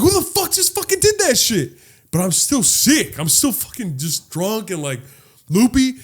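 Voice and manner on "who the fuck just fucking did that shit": high-pitched re-enacted outrage; retold outrage. Drunk man to whoever grabbed him, outraged and high. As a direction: demand an answer, voice way up high.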